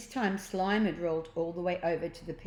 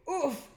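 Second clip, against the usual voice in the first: high oof from a catch; catching grunt; 9 semitones higher than usual. A narrator's reading. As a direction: grunt as she catches him. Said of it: "she exclaimed".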